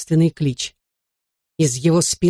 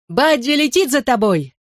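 calling out; 7 semitones higher than usual. A narrator's reading. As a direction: yell after a friend in flight. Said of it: "заорал я им вслед".

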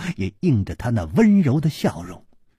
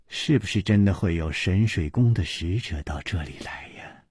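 whispered; 6 semitones lower, 5 dB quieter than usual. A narrator's reading.

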